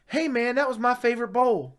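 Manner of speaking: a petty wounded squeak over a bowl; whiny complaint. Retold or imitated. Buddy's high whiny complaint retold.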